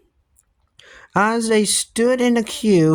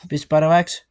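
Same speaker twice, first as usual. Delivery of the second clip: usual speaking voice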